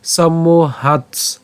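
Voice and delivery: সম্মোহাৎস slow, ending in a "ts" cluster; alternative pronunciation demo. Shown as a demonstration. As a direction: stretch সম্মোহাৎস and close on "hats". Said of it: "অথবা আমরা এভাবে উচ্চারণ করতে পারি".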